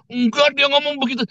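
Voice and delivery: very high-pitched contradiction; mock insistence. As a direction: imitate someone stubbornly insisting. Acted quarrel over misheard words. (menirukan orang ngotot dengan nada tinggi)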